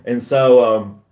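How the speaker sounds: ordinary talk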